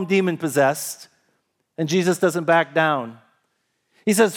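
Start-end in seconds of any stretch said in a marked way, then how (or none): none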